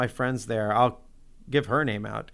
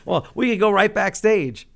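eager; 8 semitones higher, 6 dB louder than usual. Retold or imitated. Eager pitch to [name].

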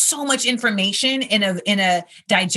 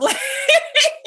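high laugh. Real, not acted. After nurse impression.

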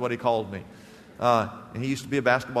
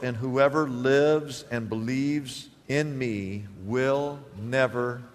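slow solemn quote; much slower than usual. Acted. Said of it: "gives me the goosebumps".

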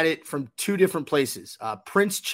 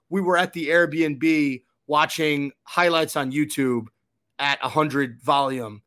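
ordinary talk

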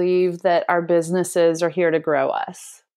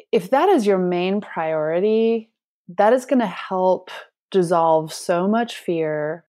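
ordinary talk, nothing marked

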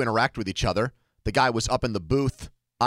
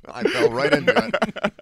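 laughing; 11 semitones higher, 4 dB louder, slower than usual. Real, not acted. Laughing at own trap.

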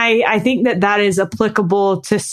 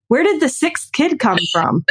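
higher, puzzled mock disbelief; baffled surprise; 6 semitones higher than usual. Reacting to a friend's Facebook surprise, in mock disbelief. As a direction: act out your bafflement at a new baby. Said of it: "I was like".

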